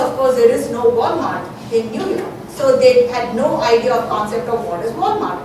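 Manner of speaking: usual speaking voice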